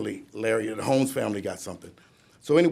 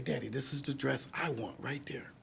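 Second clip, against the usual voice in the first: put-on hushed little-girl whisper, breath only; child's whisper; 3 semitones higher, 11 dB quieter than usual. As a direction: whisper it like an excited child pointing out the dress. Daughter whispering in the store.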